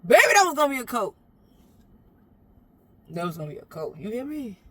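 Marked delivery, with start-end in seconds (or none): none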